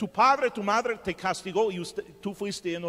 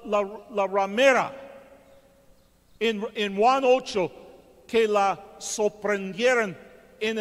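loud, driving sermon delivery, pushing every phrase; preaching at full force; 4 semitones higher, 5 dB louder than usual. Acted. Preacher retelling the adulteress story to the church, loud and driving. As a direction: preach it out to the whole congregation.

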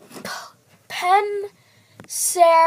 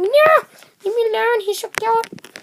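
defensive retort; 7 semitones higher than usual. Retold or imitated. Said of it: "And I'm like".

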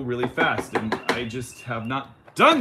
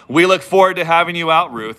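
louder, hammed-up presenter tone; mock YouTuber voice; 6 semitones higher, 6 dB louder than usual. Shown as a demonstration. Vlogger mocking his own YouTube talk, hammed-up louder. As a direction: parody the stiff YouTube host. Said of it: "That's how people talk when they do live videos on YouTube".

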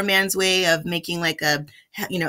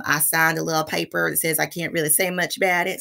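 ordinary talk

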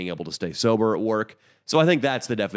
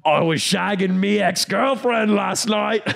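slow, loud, higher mock English lad, laughing through it; drunk English bloke; 10 semitones higher, 5 dB louder, slower than usual. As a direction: gloat about it. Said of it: "The guy turns around and goes".

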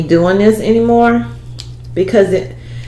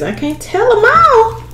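high teasing voice; 12 semitones higher than usual. Real, not acted. Teasing about her secrets.

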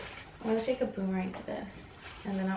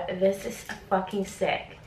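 ordinary talk, nothing marked

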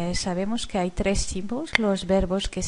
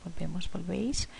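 whispered; 7 dB quieter, faster than usual. Real, not acted. Teacher reciting verb forms.

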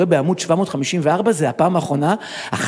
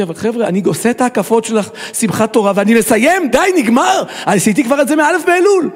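higher, louder, building insistence; exasperated protest; 7 semitones higher, 4 dB louder than usual. Acted. Worn-out worshipper tells friends he is finishing, exasperated. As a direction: put your foot down, at the end of your rope.

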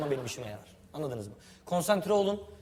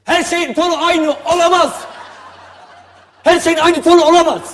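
exasperated yell; 9 semitones higher, 14 dB louder than usual. Real, not acted. Director yelling at actor.

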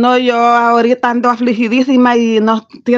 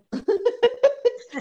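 high laugh; 10 semitones higher, 10 dB quieter than usual. Real, not acted. Guest laughs at the catchphrase.

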